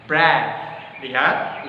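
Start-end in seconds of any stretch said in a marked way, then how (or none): 0.1-0.5 "Breath" with the th, tongue under the upper teeth; model word breath. Shown as a demonstration. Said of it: "pada kata breath". (contoh pelafalan "Breath")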